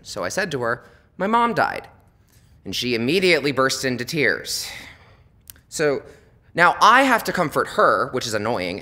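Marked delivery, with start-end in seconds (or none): 1.2-1.9 higher and louder, flat announcement; telling the cashier my mom died, high and blunt; blunt punchline. Retold or imitated. 5.7-8.1 higher, louder and slower; exasperated rant, each word dragged. Real, not acted. Exasperated comic build.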